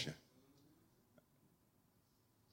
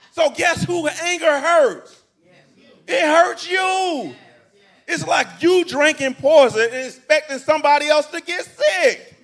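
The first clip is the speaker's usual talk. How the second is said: preaching at full force; 7 semitones higher, 7 dB louder than usual. Acted. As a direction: hammer home that anger hurts you. Preaching climax.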